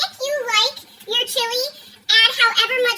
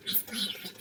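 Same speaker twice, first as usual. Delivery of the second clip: whisper; 16 dB quieter than usual. Real, not acted. Whispered aside.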